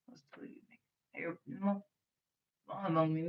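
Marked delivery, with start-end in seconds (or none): none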